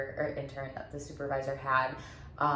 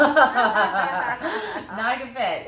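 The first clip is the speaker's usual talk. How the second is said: slow, laughing, much higher; giggling through it at a crawl; 8 semitones higher, 11 dB louder, much slower than usual. Real, not acted. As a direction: laugh the words out, high and drawn.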